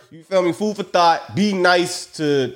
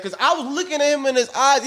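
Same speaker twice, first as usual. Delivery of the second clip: usual speaking voice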